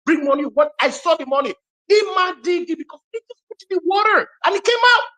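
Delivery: very high-pitched, breakneck amazement; worked-up storyteller, almost shrieking; excited retelling. Retold or imitated. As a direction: relive the marvel at the top of your pitch. Reliving excitement over conjured money.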